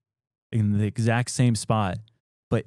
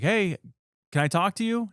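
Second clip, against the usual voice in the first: calling out; 10 semitones higher than usual. Retold or imitated. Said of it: "And he's like yelling".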